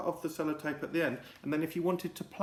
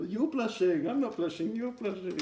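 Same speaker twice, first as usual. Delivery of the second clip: put-on high bickering kids; teasing teenagers; 8 semitones higher, 3 dB louder than usual. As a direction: tease back and forth, up high. Teen teasing exchange.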